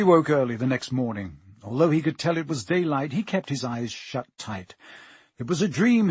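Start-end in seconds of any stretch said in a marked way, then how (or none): 5.4-6.1 firm, raised self-reassurance; firmly. A narrator's reading. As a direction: insist on it to yourself. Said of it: "he told himself firmly".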